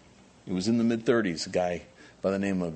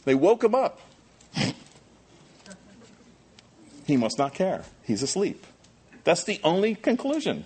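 high, loud storytelling sermon; preacher at full cry; impassioned preaching; 10 semitones higher, 6 dB louder than usual. Acted. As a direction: drive the storm story home. Pastor preaching the storm story.

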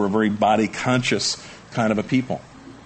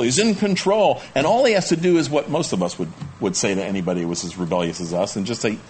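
ordinary talk, nothing marked

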